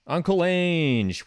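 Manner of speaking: high, drawn-out loving call; fond cheer. Real, not acted. Streamer cheering on the Tottenham manager, high and drawn out. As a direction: stretch it out with delight.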